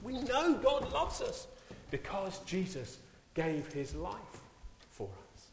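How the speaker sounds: fervent preaching. Acted. Preacher tells the church why God loves them, fervent. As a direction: lift then ease off reverently. Preaching rise then soften.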